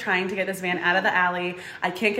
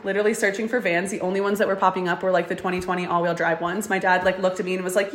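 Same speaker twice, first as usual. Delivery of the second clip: usual speaking voice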